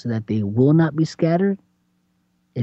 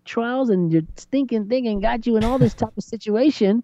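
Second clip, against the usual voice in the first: mock scolding; 8 semitones higher than usual. Scolding continues.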